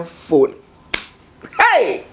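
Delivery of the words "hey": sudden high "Hey!" of disbelief; shocked exclamation. Real, not acted. Vlogger reacting to the groom on his phone, shocked shout.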